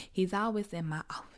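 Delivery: quick sly whisper; whispered aside. Acted. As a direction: slip it in as a secret jab. Whispered sarcastic aside to viewers.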